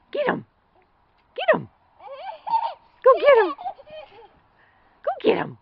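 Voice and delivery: high, loud urging cheer; excited cheering. Real, not acted. Urging toddler to chase daddy.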